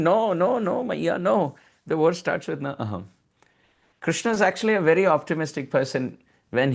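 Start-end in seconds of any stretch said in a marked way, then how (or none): 0.0-1.6 put-on raised childlike repeated no; insistent child. Child Krishna repeating no to his mother, lightly raised. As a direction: keep denying like a little boy. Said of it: "because he's repeatedly saying".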